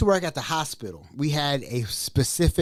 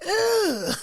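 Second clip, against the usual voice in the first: 1 disgusted. Retold or imitated. Disgusted reaction.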